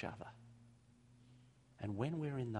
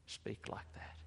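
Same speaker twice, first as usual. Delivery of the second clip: hushed; 8 dB quieter, slower than usual. Real, not acted. Narration hushed.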